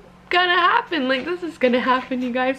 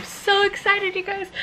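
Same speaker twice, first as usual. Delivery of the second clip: excited; 9 semitones higher than usual. Real, not acted. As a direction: gush it to the viewers. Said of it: "I'm so excited".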